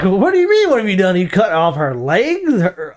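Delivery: high, disbelieving comeback; mock incredulous retort. Answering the villain in a mock retort. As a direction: scoff at her nerve. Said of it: "I'm like".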